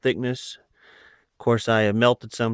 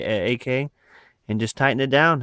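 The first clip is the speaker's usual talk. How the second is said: usual speaking voice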